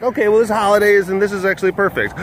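upbeat self-quote. Retold or imitated. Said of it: "and I was like".

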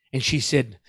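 usual speaking voice

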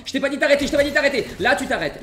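loud, high mock anger; hammed-up angry order; mock scolding. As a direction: bark at the enemy in play.

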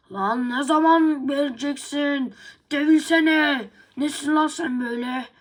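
picking a fight, loud and high; angry taunt. Acted. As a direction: shout down the rival dog. Dog taunting another dog.

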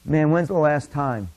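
normal talking voice

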